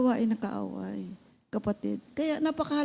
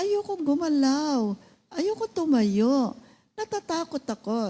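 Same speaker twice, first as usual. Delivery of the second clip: higher, slow scared voice; frightened patient refusing to get up, higher and slow; mock fearful refusal; 6 semitones higher than usual. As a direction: whimper out the refusal slowly.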